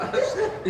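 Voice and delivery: high, loud burst of laughter. Real, not acted. Student laughing out loud while asking the teacher. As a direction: let the laugh break out loud.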